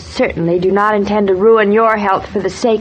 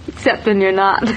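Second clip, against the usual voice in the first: teasing him through a laugh; laughing tease. Acted. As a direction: laugh it out playfully. Wife teasing husband, laughing.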